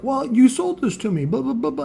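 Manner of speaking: high-pitched mimicry of an excuse; mocking customer.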